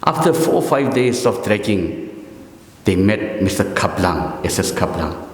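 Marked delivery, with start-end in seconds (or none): none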